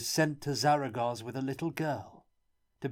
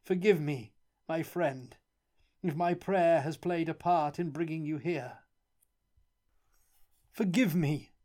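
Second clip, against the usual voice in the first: miserable, pleading plea pitched much higher; dry sobbing; 8 semitones higher than usual. A narrator's reading. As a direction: beg forgiveness through dry sobs. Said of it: "sob rather dryly".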